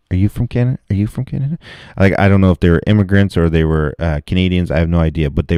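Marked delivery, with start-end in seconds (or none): 0.1-1.6 pitched up, sing-song query; higher mock questioning. At the bar, mock-grilling patrons again, high teasing. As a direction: lift it higher each time, teasing. Imagined asking, second take.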